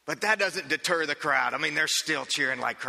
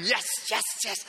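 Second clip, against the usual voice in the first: cheering crowd; 6 semitones higher than usual. Retold or imitated. Crowd screaming.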